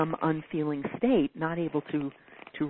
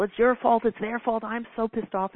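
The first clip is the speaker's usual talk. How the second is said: mock outrage; 6 semitones higher than usual. Acted outrage at others.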